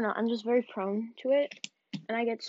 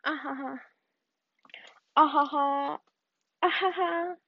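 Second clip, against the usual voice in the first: mock laugh; 4 semitones higher than usual.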